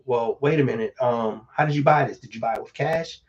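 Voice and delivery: ordinary talk, nothing marked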